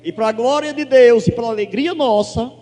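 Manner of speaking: loud shouted hallelujahs, glória stretched slow; preaching at full force. Real, not acted. Pastor celebrating the healing with the congregation, shouting praise. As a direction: shout praise to the whole church.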